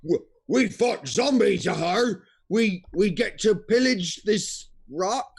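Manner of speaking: loud, eager ogre pirates; ogre pirate crew. Acted. Pirate ogres eager to raid the rock, loud and rough. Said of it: "the pirate ogre crew says".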